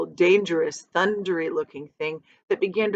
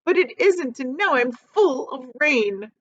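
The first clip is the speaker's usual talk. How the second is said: higher, whiny lament; complaining earthworm; 8 semitones higher than usual. A narrator's reading. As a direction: whine about the soaked skin. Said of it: "the earthworm groaned".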